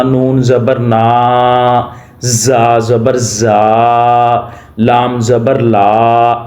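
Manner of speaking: drawn-out slow spelling; stretched out, wrong way. Shown as a demonstration. Teacher shows the wrong way to spell, dragging it slowly. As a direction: drag each syllable too long on purpose. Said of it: "ایسے نہیں کرنا".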